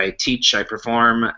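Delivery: usual speaking voice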